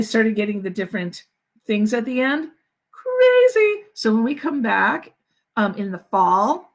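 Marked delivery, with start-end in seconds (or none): 2.9-3.8 high, loud burst of amazement; amazed exclamation. Real, not acted. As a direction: squeal it out in disbelief. Reacts to hard song.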